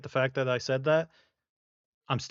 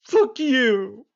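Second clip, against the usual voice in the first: high, loud mock outburst; mock yelling at mom; 11 semitones higher, 7 dB louder than usual. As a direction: yell it at Mom, hammed-up. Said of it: "that's exactly what I said".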